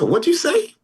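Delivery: retold high-pitched startled question; startled disbelief. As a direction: ask it as if taken aback. Retold startled reply to friend.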